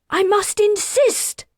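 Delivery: very high, loud firm demand; stepping in to stop him; insistent shout. Acted. Attendant confronts father.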